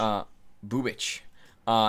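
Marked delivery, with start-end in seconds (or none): 0.7-1.2 Bubic as "BOO-bitch"; trying a pronunciation. Real, not acted. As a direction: say Bubic with a final "ch".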